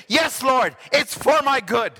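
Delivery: fervent shout. Acted. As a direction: call it out in praise.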